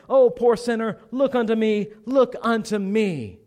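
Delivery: loud, high cries on each "Look unto me"; impassioned preaching. Acted. Preacher urging a poor sinner to look to Christ, impassioned loud appeal. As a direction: plead with rising fervour, dropping slightly between cries. Acting out preacher's mounting plea.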